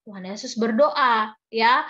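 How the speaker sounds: ordinary delivery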